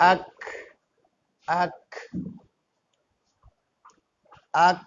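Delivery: আক with a short bounce on the final ক; qalqalah demo. Shown as a demonstration. Teacher demonstrates আক to students, light bounce on ক. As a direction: say আক, letting the stopped ক give a small echo. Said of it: "যেমন".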